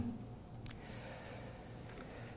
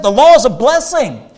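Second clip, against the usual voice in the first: higher and louder, leaning on law; emphatic repeat; 7 semitones higher, 5 dB louder than usual. Acted. Preacher defending the Old Testament law, emphatic loud repeat. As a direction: drive the point home the second time.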